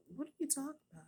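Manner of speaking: high-pitched incredulous question; retold bafflement. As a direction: act out a mother who can't believe it. Mother retells baffled reply.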